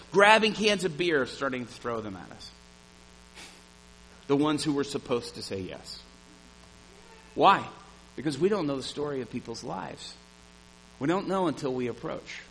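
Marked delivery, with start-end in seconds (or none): none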